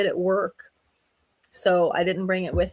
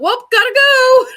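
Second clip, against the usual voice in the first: high, loud sing-out excuse; breezy getaway; 12 dB louder, slower than usual. Retold or imitated. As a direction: call it out big as you bail. Retells bailing on shoveling help.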